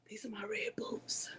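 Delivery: hushed, secretive whisper. Real, not acted. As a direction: breathe it like a secret. Showing off her boots on camera.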